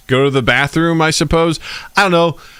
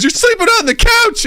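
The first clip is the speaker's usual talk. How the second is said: mock scolding wife. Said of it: "She goes".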